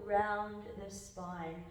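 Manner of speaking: normal talking voice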